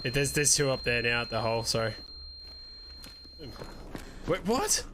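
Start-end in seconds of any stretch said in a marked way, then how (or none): 4.3-4.8 sharp high disbelief; surprised. Real, not acted. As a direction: jump up in pitch, baffled. Sudden confusion.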